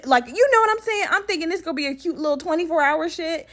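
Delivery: high-pitched indignant disbelief; squeaky, hammed-up protest; incredulous. Patient expecting a twenty-four hour stay, incredulous.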